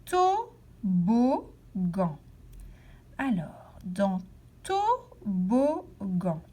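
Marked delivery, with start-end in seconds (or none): 0.1-1.4 "toboggan" slowly split into separate syllables. Shown as a demonstration. Teacher helping pupils hunt for a syllable, saying "toboggan" syllable by syllable. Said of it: "Nous allons chercher la syllabe". 4.6-6.4 "toboggan" slowly split into separate syllables. Shown as a demonstration. Teacher helping pupils hunt for a syllable, saying "toboggan" syllable by syllable. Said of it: "à quel endroit j'entends la syllabe".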